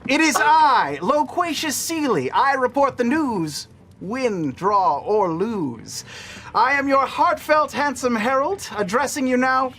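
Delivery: grand news address to the cities; a herald addressing two cities on air; pompous herald broadcast. Acted. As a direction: proclaim it like a town crier.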